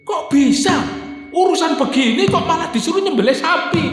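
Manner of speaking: high, indignant shouting protest; mock furious yell, pitched high; angry outburst. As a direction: play the outraged crowd yelling back. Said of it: "Marah-marah ini, Pak".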